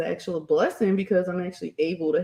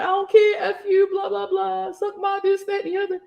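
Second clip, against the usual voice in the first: defiant brush-off; 11 semitones higher than usual. Retold or imitated. Answering people calling her names, high defiant brush-off. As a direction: toss it off like you couldn't care less. Defiant reply to online insults.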